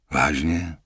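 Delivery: weakly. Acted. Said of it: "zeptal jsem se zíhle".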